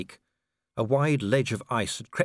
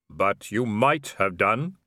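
drawn-out reaper musing; grim reaper voice; slower than usual. Acted. Death speaking.

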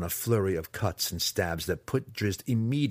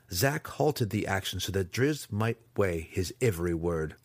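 plain reading voice

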